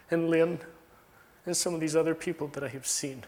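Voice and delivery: ordinary talk, nothing marked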